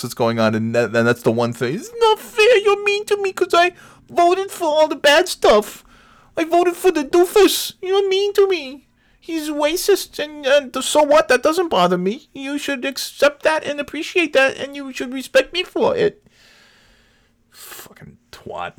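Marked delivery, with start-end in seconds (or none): 1.7-16.2 very high, whining babyish mock; whiny mock voice. 17.5-18.7 whispered insult, slow; muttered whisper. Real, not acted.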